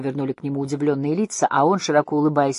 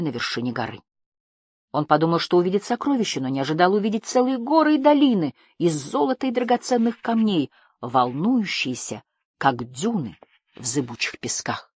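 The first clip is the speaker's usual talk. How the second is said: ordinary delivery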